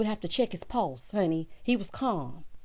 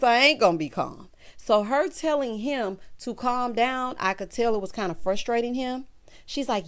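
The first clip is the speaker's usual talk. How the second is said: usual speaking voice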